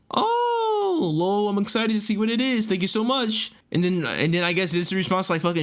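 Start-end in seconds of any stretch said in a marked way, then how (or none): 0.1-3.5 put-on high, girlish squeal of thanks; hammed-up delighted reply; gushing excitement. Acts out her excited reply.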